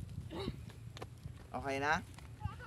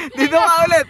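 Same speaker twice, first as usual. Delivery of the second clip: calling out; 5 semitones higher, 6 dB louder, faster than usual. Real, not acted. Calling player back.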